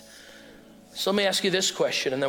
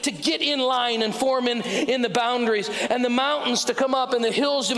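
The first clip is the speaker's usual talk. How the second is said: preaching at full force; 9 semitones higher than usual. Acted. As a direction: proclaim it slowly to the crowd, rising. Sermon climax preaching.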